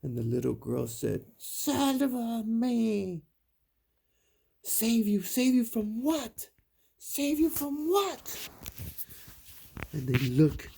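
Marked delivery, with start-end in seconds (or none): 1.4-3.2 put-on high, drawn-out plea of a ghost girl; ghostly little girl. A narrator's reading. Storyteller as ghost girl to frightened brothers, wailing plea. 4.6-8.5 high, loud, increasingly frantic questioning; panicked brothers. A narrator's reading. Storyteller as scared brothers answering ghost girl, panicked.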